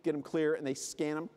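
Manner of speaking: normal talking voice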